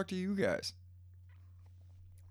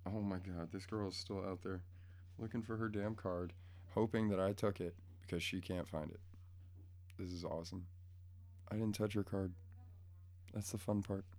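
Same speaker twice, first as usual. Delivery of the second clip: quiet aside; 2 semitones lower, 8 dB quieter than usual. Real, not acted. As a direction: keep it down so she won't hear. Talking low about girl outside.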